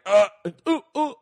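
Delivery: high mock ooh moans, hammed-up; mock climax moan. A man retelling his premature finish with high mock moans. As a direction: play a guy finishing too soon with squeaky oohs.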